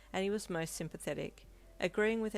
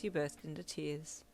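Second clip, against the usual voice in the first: usual speaking voice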